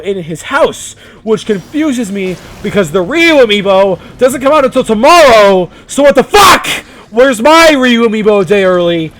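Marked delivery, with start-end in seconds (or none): 2.6-9.1 loud, higher, incredulous shout; confused outrage. Real, not acted. Vlogger shouting in disbelief about a friend's early amiibo. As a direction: demand to know how he got it early. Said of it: "I'm confused".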